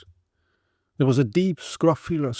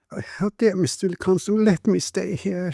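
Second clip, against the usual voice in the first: tearful plea; 7 semitones higher than usual. A narrator's reading. Weeping mother begs the constable to let her stay. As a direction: beg to stay, half crying. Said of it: "she lisped through her tears".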